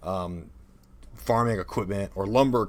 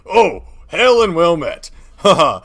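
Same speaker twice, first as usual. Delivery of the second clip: hammed-up, loud game guard; town guard; 5 semitones higher, 9 dB louder than usual. Town's guard answering a passing adventurer, loud hammed-up NPC voice. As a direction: hail the adventurer with booming scripted cheer. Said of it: "walk up to a town's guard".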